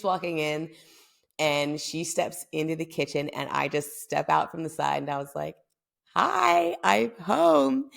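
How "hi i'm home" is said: high, sing-out hello; surprise greeting. Retold or imitated. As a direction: pop out and announce it in a high voice. Acting out her surprise greeting.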